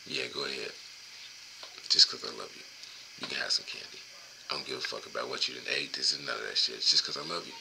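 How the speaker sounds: dad quietly letting his son have candy; soft dad reply. Retold or imitated. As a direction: give in gently to the kid. Dad answering kid softly.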